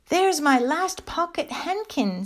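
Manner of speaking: put-on high, slow childlike exclamation; pleased child. A narrator's reading. Lucy spotting her last handkerchief, high pleased voice. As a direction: exclaim it high as a little girl.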